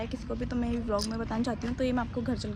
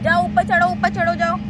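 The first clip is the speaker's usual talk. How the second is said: high loud coaxing over the fair noise; loud urging; 6 semitones higher, 11 dB louder than usual. Real, not acted. Urging child onto the ride. (मेले के शोर में बच्चे को ज़ोर से उकसाना)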